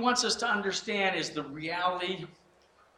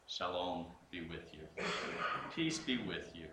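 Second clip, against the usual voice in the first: gentle greeting; 10 semitones lower, 13 dB quieter than usual. Acted. Risen Jesus greets disciples.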